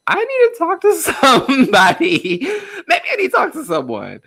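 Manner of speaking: high, laughing and half-crying plea; retold panicky laughing. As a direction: laugh through tears while asking for help. Retold panic, laughing through tears.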